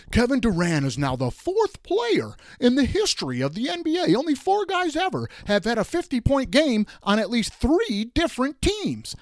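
hyped-up high, loud announcer parody; mocking radio hype. Host mocking radio shows hyping a meaningless stat, loud and high. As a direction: ham up the breathless stat-reading announcer. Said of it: "all I heard on every radio show was".

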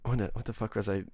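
ordinary delivery

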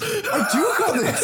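high drawn-out marvel; amazed. Retold or imitated. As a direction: savour the surprise of your own strength. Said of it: "look at him going".

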